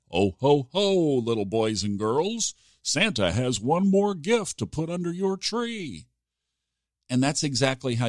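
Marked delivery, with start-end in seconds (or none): 0.1-6.1 jolly Santa, higher and hearty. Acted. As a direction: play Santa to the kids, booming cheer. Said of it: "Like I said".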